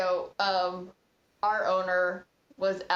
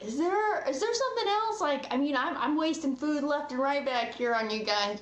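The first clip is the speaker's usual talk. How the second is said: high-pitched exasperated complaint; retold scolding; 6 semitones higher than usual. Owner getting on to a table sending food back, exasperated. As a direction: imitate a boss who has lost patience. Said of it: "kinda getting on to him".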